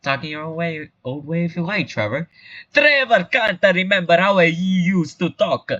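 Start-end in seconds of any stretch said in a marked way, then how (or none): 2.7-5.7 put-on higher, plaintive broad Italian accent. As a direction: lament it high in thick stage Italian. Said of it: "talk like a broad Italian stereotype".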